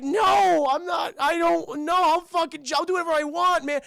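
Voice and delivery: high, loud acted protest; defiant yell. Cuffed jumper defying the cop, high and yelling. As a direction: shout back in a frantic mock argument.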